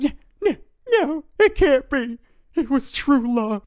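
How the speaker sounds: put-on high, whiny kid near tears; crying kid voice. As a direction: wail it like a heartbroken boy. Kid denies being scammed.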